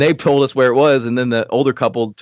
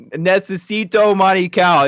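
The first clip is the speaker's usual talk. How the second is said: halting Spanish; 7 semitones higher, slower than usual. Retold or imitated. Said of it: "I was like".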